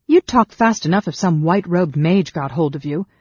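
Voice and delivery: loud, grim warning; grim prediction. A narrator's reading. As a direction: press the threat home firmly. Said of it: "Sarah predicted grimly".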